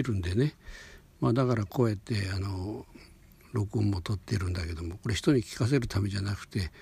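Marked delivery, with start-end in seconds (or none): none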